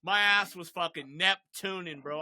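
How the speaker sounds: ordinary talk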